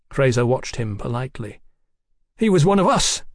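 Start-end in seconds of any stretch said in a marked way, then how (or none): 2.4-3.2 sudden, higher and louder outburst; blurted. A narrator's reading. Mallory blurts out his defence of the dead Rudwick. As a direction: blurt it out before you can stop. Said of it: "Mallory blurted".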